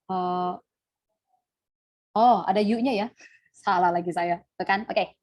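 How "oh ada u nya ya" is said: ordinary talk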